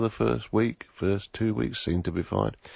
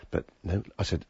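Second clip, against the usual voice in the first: ordinary delivery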